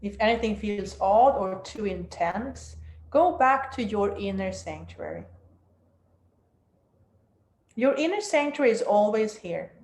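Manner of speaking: guided meditation. Acted. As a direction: guide them down into stillness.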